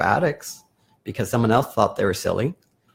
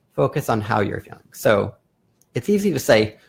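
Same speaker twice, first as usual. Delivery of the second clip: normal talking voice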